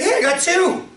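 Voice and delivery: growing excitement at a second match; mock excited player. Ad character voiced in story.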